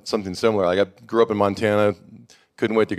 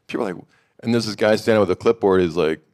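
ordinary delivery

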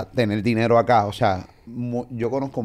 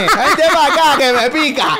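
high, loud, laughing mock invitation; mock come-on; 8 dB louder than usual. Friend jokingly calling a fling over, hammed-up laughing shout. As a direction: play a shrieking come-on while cracking up.

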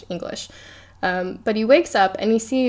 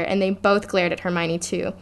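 usual speaking voice

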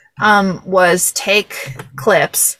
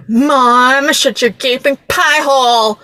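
higher, louder imitation of an exasperated daughter; hammed-up telling-off of a mother; mock bratty outburst; 5 semitones higher, 4 dB louder, slower than usual. Quoting the daughter who shuts up her mom, mock exasperated.

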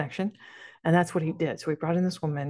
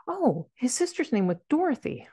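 retold higher-pitched surprised discovery; sudden realization; 8 semitones higher than usual. Client realizing the Kansas clue fits, imitated surprise. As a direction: let the connection dawn on you, pitched up.

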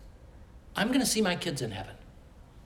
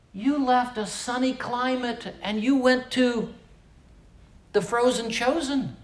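mock disbelief; 10 semitones higher, slower than usual. People mocking his move north.

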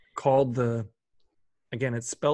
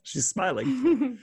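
laughing; 8 semitones higher, much slower than usual. Real, not acted. Teasing about friend's smile.

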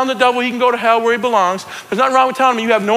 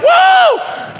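very high shouted whoop; football crowd whoop; 4 dB louder than usual. Acted. Imitating a fan at a football game, shouted whoop. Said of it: "We shout at a football game".

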